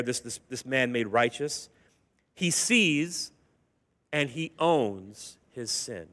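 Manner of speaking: ordinary talk, nothing marked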